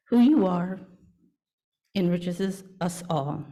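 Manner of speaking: ordinary talk, nothing marked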